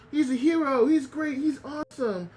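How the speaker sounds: high, eager fan voice; gushing dog. Retold or imitated. Dog praising the hero Muchan, high and gushing. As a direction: pitch it up and gush about the hero. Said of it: "And Mutan's like".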